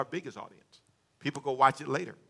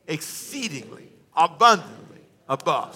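slow, weighty "Exceedingly, abundantly above", punched on "abundantly"; emphatic stress; 5 dB louder, slower than usual. Shown as a demonstration. Preacher has congregation repeat the verse, emphatic. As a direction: draw out "Exceedingly", then hit "abundantly" loud.

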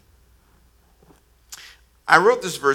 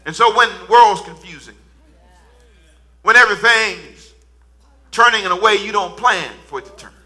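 higher, louder preaching cadence; full preaching; 9 semitones higher, 11 dB louder than usual. Acted. Pastor preaches to the congregation in a lifting cadence. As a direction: preach it with rising conviction.

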